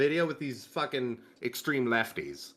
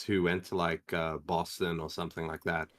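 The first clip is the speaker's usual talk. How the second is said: usual speaking voice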